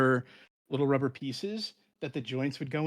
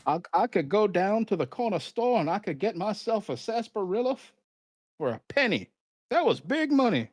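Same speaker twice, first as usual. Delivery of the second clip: put-on cackly wheezy old timer; 7 semitones higher than usual. Continues the old-timer bit.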